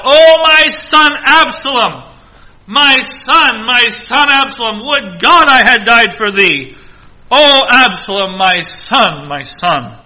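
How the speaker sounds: loud, high anguished wail of a father; preacher voicing David's grief at full force; anguished cry. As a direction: cry out for a lost son. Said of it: "As he is crying that out".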